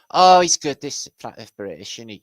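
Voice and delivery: high, loud gushing; hammed-up high praise; mock fan gush.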